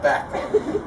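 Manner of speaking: breaks into a laugh on the word; laughing fondly at the memory. Real, not acted. Uncle at wedding laughs recalling the boy, laughing.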